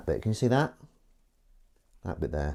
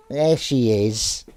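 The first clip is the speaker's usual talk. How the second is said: tender cooing; slower than usual. Real, not acted. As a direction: coo slowly over a sweet kitten. Cooing at the kitten.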